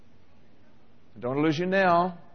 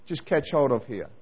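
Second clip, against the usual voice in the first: ordinary talk, nothing marked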